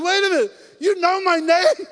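loud, high, slowing astonished question; stunned that he knows you; amazed disbelief. Retold or imitated. Preacher retelling his stunned reply to God, loud. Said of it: "I'm like".

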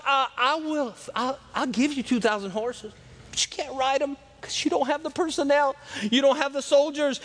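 high-pitched, lightly acted taunt; trash talking. Envoy mocks their lack of riders and soldiers, trash talking. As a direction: needle them about what they lack. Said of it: "he keeps on trash talking".

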